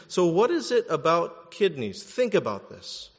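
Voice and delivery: usual speaking voice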